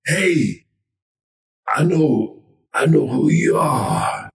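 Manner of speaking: put-on halting old man's voice, drawn out; slowly dawning recognition in an aged voice; elderly man. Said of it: "I see this old man".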